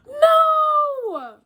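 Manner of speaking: high, loud cry of no; elephant's desperate shout of no; anguished no. Retold or imitated. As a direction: cry out no in alarm. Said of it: "He's going".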